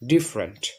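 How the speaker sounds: ডিফারেন্ট said in two syllables, "dif-rent"; correct pronunciation. Shown as a demonstration. Teacher modelling the correct form for a student, ডিফারেন্ট as "dif-rent". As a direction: drop the middle vowel of ডিফারেন্ট: "DIF-rent". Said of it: "সুতরাং আমরা এটা উচ্চারণ করব".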